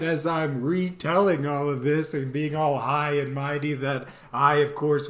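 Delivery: ordinary talk, nothing marked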